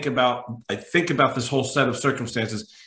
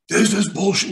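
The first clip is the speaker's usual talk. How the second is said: a mock yell, pitched up; retold angry yell; 8 semitones higher, 3 dB louder than usual. Passenger yelling at ticket agent over phone, mock yell. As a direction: rant at the phone in mock fury. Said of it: "He's screaming in the phone".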